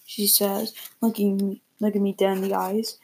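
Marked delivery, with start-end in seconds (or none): none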